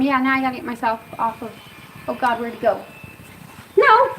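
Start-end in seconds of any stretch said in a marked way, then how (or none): 3.8-4.1 high, loud panicked no's; terrified shriek at a bug; real scream. Real, not acted. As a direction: shriek as the bug comes close. A huge bug comes near.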